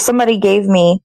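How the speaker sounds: normal talking voice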